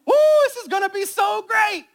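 high, loud hammed-up cheer; mock excitement. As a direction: play the overeager beginner. Mocking eager beginners' excitement.

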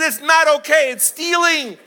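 high, emphatic mock outrage; loud correction. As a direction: object loudly, pitch right up. Dad firmly corrects crying manager.

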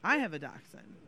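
ordinary talk

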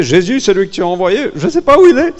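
quick, rising into a loud high cry; exasperated plea. Acted. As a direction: build to a frantic high outburst on the last words. Acting people speaking to God.

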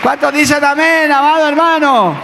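rally the room for an amen; rousing call. Real, not acted. Preacher calling on the congregation for an amen, loud and rousing.

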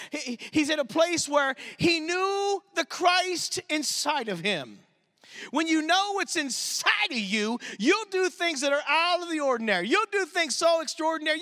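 rising pulpit intensity; preaching fervor. Acted. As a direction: drive it home to the congregation. Preaching climax about Christ inside.

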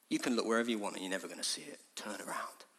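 low, very soft warning; low, subdued appeal; hushed plea. Acted. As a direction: say it quietly, almost under the voice. Hushed plea after shouting.

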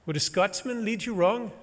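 high Scottish brogue with a teasing rise on "wrong"; Scottish accent. Pretend. Guide William teasing the nervous hiker, Scottish accent. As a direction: sell it like a cheeky Scottish guide. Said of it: "here comes an accent".

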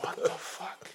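breathless stunned whisper; shocked whisper. Retold or imitated. Friend finding the truck gone, stunned whisper. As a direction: mouth it in disbelief.

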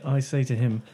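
usual speaking voice

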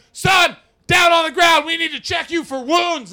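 loud, high police command; shouting cop. Retold or imitated. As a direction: bark orders like an officer. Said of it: "And I go to around there".

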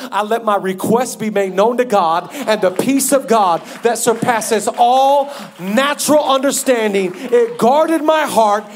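loud, high, rhythmic declaration; preaching at full force. Real, not acted. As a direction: build to a ringing proclamation.

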